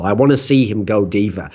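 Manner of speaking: usual speaking voice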